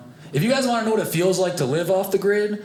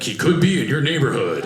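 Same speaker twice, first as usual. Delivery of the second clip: TV narrator. Retold or imitated. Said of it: "I hear the guy on the T V".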